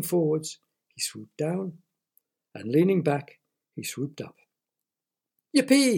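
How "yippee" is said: high, loud whoop of glee; yelled. A narrator's reading. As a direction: yell it out in delight. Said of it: "he yelled".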